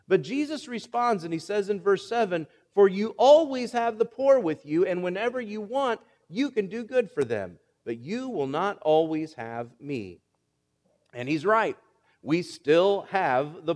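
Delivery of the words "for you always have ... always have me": scripture reading. Acted.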